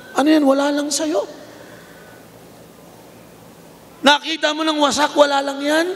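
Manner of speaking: climbing high, insistent rebuke; rising rebuke. Acted. Preacher rebuking the congregation in God's voice, rising and insistent. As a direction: press them, voice rising each line.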